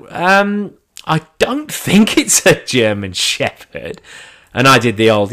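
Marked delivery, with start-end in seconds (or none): 0.1-4.0 slow, higher-pitched hesitant imitation; awkwardly doubtful trainer. Trainer doubts it's a German Shepherd, awkwardly doubtful. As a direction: correct him haltingly with an awkward laugh.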